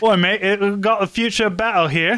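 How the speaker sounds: put-on British soldier parody with a Cockney accent; mock Cockney. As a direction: put on a hammed-up Cockney lad. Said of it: "sound like you're trying to be Cockney".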